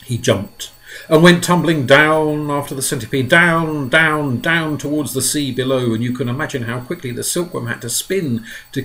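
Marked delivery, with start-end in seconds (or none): none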